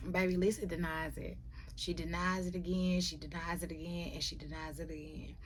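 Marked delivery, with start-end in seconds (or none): none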